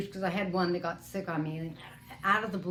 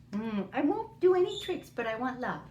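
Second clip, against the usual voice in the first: voicing the parrot; 4 semitones higher than usual.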